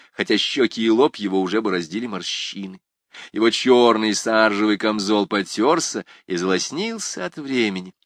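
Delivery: plain reading voice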